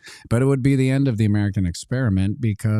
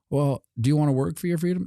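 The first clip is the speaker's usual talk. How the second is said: fast mock official; 7 semitones higher, faster than usual.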